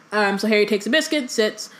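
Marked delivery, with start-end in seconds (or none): none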